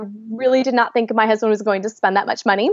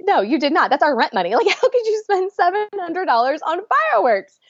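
higher, mock-outraged disbelief; disbelieving; 7 semitones higher than usual. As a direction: retell the shock at the rent money spent. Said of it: "I kept looking at him like".

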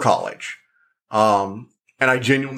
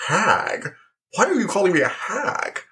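high, mock-offended protest; affronted day hag; 7 semitones higher than usual. Day hag objecting to what friends called her, mock-offended. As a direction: voice an indignant hag, pitched up.

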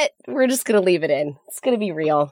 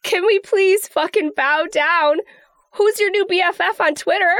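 excited hype; 9 semitones higher than usual. Real, not acted. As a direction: cheer her on like big news. Hyping friend's Twitter fame.

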